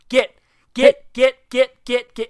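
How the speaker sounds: high, loud repeated get, like chasing off a dog; loud shooing. Retold or imitated. Shooing the kid out of the building, loud and high. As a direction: shoo him out with sharp loud calls. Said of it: "I just went".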